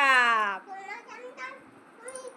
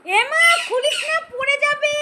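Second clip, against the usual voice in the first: sharp warning shout; 10 semitones higher, 3 dB louder than usual. Real, not acted. Mother shouting at her child not to open it, alarmed warning shout. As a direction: shout it high and loud to stop her.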